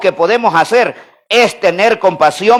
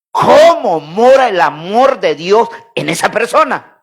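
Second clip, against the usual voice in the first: slow, measured preaching from the Bible; spaced phrases, each key word stretched out; scripture recitation; 2 semitones higher, slower than usual. Real, not acted. Preacher quotes First John to the church, slow scripture recitation.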